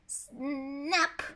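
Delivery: usual speaking voice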